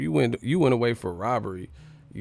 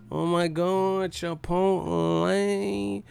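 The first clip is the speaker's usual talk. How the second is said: very slow, high mock voice; 8 semitones higher, much slower than usual. Gushing about Chipotle, very slow and high.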